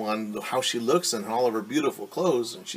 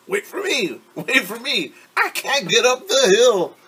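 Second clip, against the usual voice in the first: higher, strained cry to her friends running ahead; a winded little girl calling after her friends; calling out, out of breath; 9 semitones higher, 6 dB louder than usual. Acted. Ari lagging behind, calling out.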